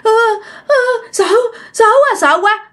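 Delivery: high, stammering, frightened voice; terrified stammer. Retold or imitated. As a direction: stutter out the fear, barely able to speak. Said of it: "ổng lắp bắp". (lắp bắp, run sợ, giọng cao)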